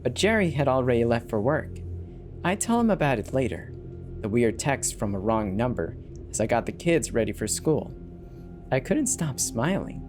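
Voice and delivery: plain reading voice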